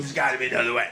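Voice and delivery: low, quiet crook voice; dad pushing the principal, low and quiet; low gangster dad. Retold or imitated. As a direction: lean in low like a mobster. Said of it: "My dad goes".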